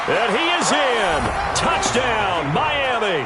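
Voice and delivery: excited high shout of a score; touchdown call. Acted.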